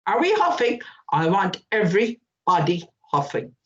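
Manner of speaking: usual speaking voice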